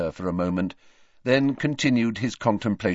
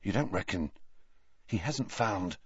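hushed; 8 dB quieter than usual. Acted. Hushed follow-ups after whisper.

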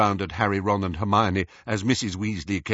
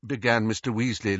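ordinary delivery

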